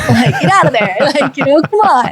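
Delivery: laughing through a mock order. Coach shooing an artist out of their rut, laughing. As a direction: chuckle while shooing them out. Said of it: "and go".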